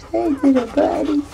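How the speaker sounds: very high squeaky puppy voice. Real, not acted. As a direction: squeak it to the pup. Greeting a puppy.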